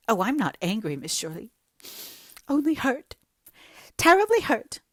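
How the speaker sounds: high, loud tearful protest rising to a wail; voice foggy with tears. Acted. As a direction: choke it out as if tears blur the voice. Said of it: "a voice positively foggy with tears".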